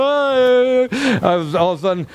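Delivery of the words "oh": high, long wailing "oh"; hammed-up blubbering cry; mock tearful wail. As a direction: imitate yourself welling up. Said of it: "I was sitting there going".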